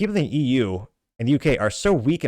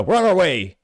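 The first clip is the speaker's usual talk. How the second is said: louder, higher, eager call; eager police response; 7 semitones higher, 6 dB louder than usual. Acted. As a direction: voice gung-ho police rushing off. Police reply in comic.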